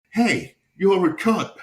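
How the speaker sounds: high, slow, loud fake-innocent insult; mock shout. Joke narrator greets a crying stranger with a slow mock shout. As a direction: belt it out like a breezy greeting.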